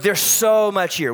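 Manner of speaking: ordinary talk, nothing marked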